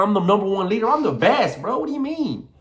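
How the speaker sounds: high, forceful self-belief; emphatic boast, climbing in pitch; strong. Shown as a demonstration. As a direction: declare it with cocky certainty. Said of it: "Everything strong".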